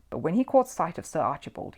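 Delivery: ordinary delivery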